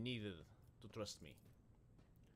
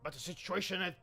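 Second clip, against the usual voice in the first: raised captain voice; 7 semitones higher, 9 dB louder than usual. Acted. Lord Captain snaps back.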